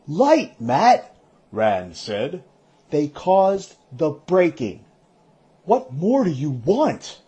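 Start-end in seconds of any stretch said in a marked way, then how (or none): 0.1-1.2 sharp high startled cry; shocked exclamation. Acted. Rand shocked at Mat. 2.9-7.2 insistent. Acted. Rand insists to Mat.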